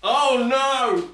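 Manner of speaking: loud, high "oh no"; sudden dismay as it tears; alarmed cry. Real, not acted. Bag breaks.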